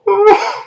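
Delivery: high-pitched tearful gasp; hammed-up crying intake of breath; sobbing gasp. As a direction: gasp as if breaking down. Breaking down crying over cards.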